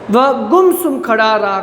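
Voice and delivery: ordinary talk, nothing marked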